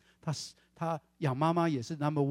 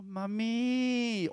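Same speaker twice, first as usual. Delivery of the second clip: put-on sweet girl voice; 7 semitones higher than usual. 女孩在电话里叫妈妈.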